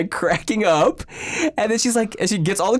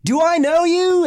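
confused stranger; 4 dB louder than usual. Retold or imitated. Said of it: "And the guy's like".

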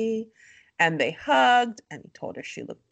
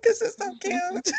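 giggly gush; 13 semitones higher than usual. Retold or imitated. Gushing over couple, laughing.

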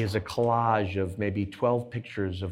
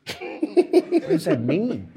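shocked question; 9 semitones higher, 3 dB louder, much slower than usual. Retold or imitated. Retold shocked reply to banker.

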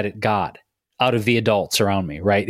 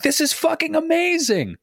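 jubilant, much higher exclamation; exalted. Shown as a demonstration. Celebrating a big win, exalted high cry. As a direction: burst out with pure joy at a success. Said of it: "maybe you are exalted".

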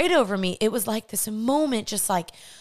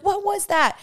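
put-on much higher, startled kids shrieking the question; shocked shriek; 10 semitones higher, slower than usual. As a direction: squeal it like kids catching a shock on TV. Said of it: "we all literally started screaming".

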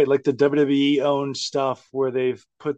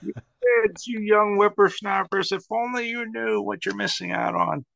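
put-on old man voice; 7 semitones higher than usual. Mock old geezer scolding kids.

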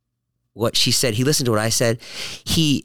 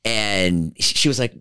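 usual speaking voice

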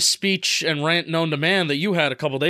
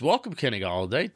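ordinary delivery

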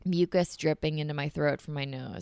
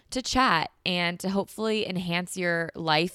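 ordinary delivery

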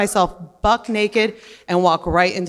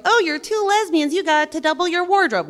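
mocking quote; 8 semitones higher than usual. People's clichéd remark mocked.

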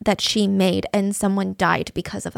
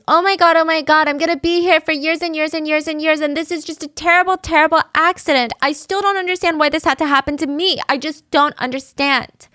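mock screaming; 6 semitones higher than usual. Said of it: "And she screams".

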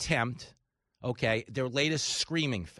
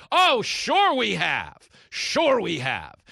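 sarcastic; 4 semitones higher, 8 dB louder, much slower than usual. Real, not acted. Sarcastic retort to Democrats.

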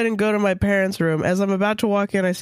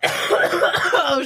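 laughing; 9 semitones higher, much slower than usual. Real, not acted. Cracking up at the vet joke.

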